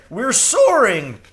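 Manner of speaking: very high, loud bird exclaim; delighted crow. A narrator's reading. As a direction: cry it out gleeful. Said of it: "he said".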